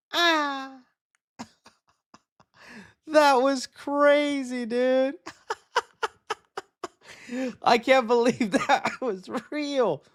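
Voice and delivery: high, slow laughing amazement; laughing disbelief. Real, not acted. Streamer laughing at the casting reveal, high giddy. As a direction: laugh through the shock.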